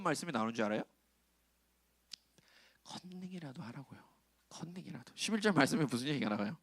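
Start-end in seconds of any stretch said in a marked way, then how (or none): 2.8-4.0 a quiet conspiratorial aside; murmured joke; hushed joke. Real, not acted. Joking aside to congregation. 4.5-5.1 컨닝이라도 again, soft and falling; soft echo of the joke; hushed repeat. Real, not acted.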